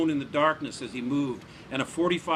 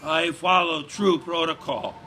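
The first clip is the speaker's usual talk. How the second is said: weary; 4 semitones higher, 5 dB louder than usual. A narrator's reading. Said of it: "the beaten man replied wearily".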